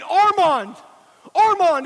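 loud, high-pitched yell of a name. Retold or imitated. Father calling into the rubble for his son, yelling. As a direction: call out hard for a missing son. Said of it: "he begins yelling".

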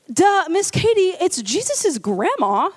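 put-on slow, obvious duh from a little girl; slow know-it-all toddler. Girl telling the babysitter the line is grandma, slow childlike. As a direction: spell it out like the babysitter is dim.

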